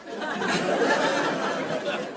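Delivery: breathless airy laugh; a soft laugh that cracks up at the story. Real, not acted. Host cracking up at the lawyer story, airy laugh.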